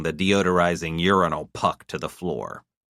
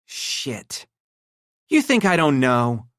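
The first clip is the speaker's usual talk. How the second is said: bitter; 8 semitones higher, slower than usual. A narrator's reading. Estelle voiced.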